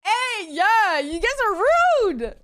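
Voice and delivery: indignant retort. Real, not acted. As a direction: scold the hecklers, half-playfully. Snapping back at chat's jab.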